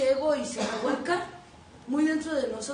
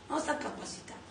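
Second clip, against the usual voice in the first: whispered verdict; 8 dB quieter, faster than usual. Retold or imitated.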